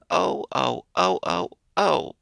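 rising wailing sobs, high at the end; a puppet howling with hunger; childlike crying oh-oh-oh. A narrator's reading. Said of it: "began to cry and to roar".

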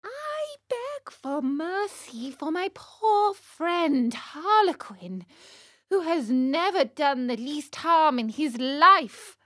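high, slow, earnest begging; high marionette voice, beseeching; pleading puppet boy. Acted. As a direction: implore for your friend's life, drawn out. Pinocchio pleads for Harlequin.

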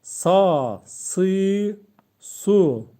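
sa, si, su said syllable by syllable; Arabic letter demo. Shown as a demonstration. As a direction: sound out sa si su clearly.

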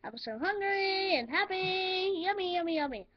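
slow, high, gleeful sing-song; happy sing-song. Real, not acted. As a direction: savour every word, hungry and happy. Pizza has arrived.

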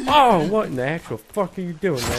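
high, loud, baffled yell; exasperated shout. Real, not acted. Player yelling at the game's other survivors, exasperated shout. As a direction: shout at them in disbelief.

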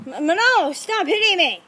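loud, high complaint; exasperated protest. Acted. Parent telling Manolo to stop hitting, exasperated. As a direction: yell in protest at being hit.

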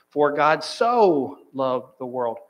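slow line with a heavy, lingering SO; stress on SO. Shown as a demonstration. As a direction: draw out the word "so". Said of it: "emphasize every word of the passage".

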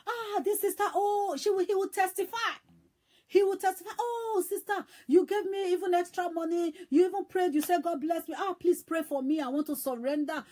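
high-pitched, gushing gratitude; delighted testimony. Retold or imitated. Grateful brother asks sister for prayer, excitedly. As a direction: act out an overjoyed man praising his helper.

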